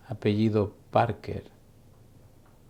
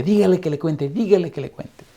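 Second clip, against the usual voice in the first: put-on higher, louder excited old lady; eager urging; 7 semitones higher, 4 dB louder than usual. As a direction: urge him on, bursting with joy. Excited mother urges son.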